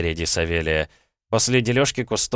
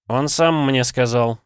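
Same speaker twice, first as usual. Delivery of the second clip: drawn-out, louder distracted answer; slow, higher-pitched offhand reply; absent-minded; 6 semitones higher, 5 dB louder, slower than usual. A narrator's reading. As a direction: answer slowly, mind elsewhere. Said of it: "ответил я рассеянно".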